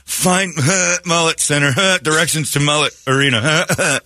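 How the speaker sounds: high, hiccuping sobs through the words; sobbing into phone. Retold or imitated. Lost man sobbing directions into his phone, high hiccuping sobs. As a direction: gasp out the words between sobs. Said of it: "I got the tears starting".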